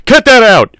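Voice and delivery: high, loud angry yell. A narrator's reading. Man knocked flat yelling at the dinosaur, angry yell. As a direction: yell it at full force. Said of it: "Regis yelled".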